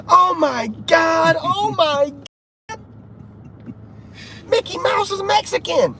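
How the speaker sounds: high, slow panicked yell; mock screaming crowd. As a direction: shriek it like a shocked crowd. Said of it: "everybody was screaming".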